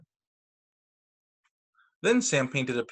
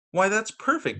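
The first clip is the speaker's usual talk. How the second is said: delighted praise; 6 semitones higher than usual. Acted. Mother praises Sam.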